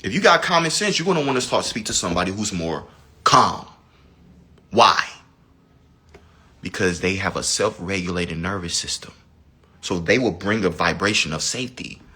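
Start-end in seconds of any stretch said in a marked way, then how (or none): none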